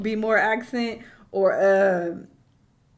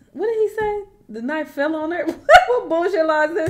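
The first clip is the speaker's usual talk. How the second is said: high, incredulous, breaking into laughter; laughing disbelief; 8 semitones higher, 6 dB louder than usual. Real, not acted. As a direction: rise high and loud into a real laugh.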